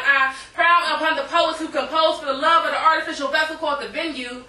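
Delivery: rhythmic spoken word performance; poem. Acted. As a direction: perform it like slam poetry on stage.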